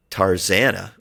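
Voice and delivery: usual speaking voice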